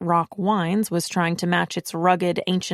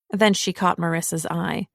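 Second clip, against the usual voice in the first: ordinary delivery, nothing marked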